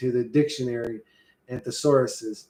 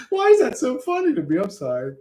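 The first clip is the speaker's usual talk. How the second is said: laughing; 8 semitones higher than usual. Real, not acted. Still laughing at own attempt.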